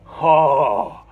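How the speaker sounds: loud, drawn-out awestruck "oh"; geeking out. Retold or imitated. Jay seeing the rare disc, retold geeking out. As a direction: imitate a fan bowled over. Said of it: "he started geeking over this thing".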